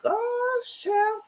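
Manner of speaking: an exaggerated scandalized cry; high falsetto disbelief; shocked high squeal. Real, not acted. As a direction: jump way up into a high falsetto on gosh. Exclaiming at the message.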